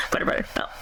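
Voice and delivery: whispering butter, butter to the cat; soft unvoiced call to a pet; hushed call. Real, not acted. Owner whispering to her cat: hushed call.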